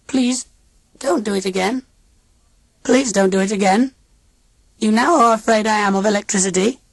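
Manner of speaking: put-on high, stiff alien old lady; robot aunt voice. A narrator's reading. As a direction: speak like a cold machine aunt.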